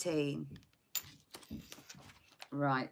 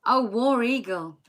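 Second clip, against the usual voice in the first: higher, louder mock Southern cheer; Southern accent; 5 semitones higher, 7 dB louder than usual. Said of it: "It's the accent, isn't it?".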